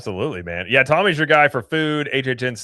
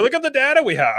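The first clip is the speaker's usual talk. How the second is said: high, showy mock brag; lightly voiced bragging data nerd; mock boastful; 11 semitones higher than usual. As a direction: show off the data like a proud pitchman.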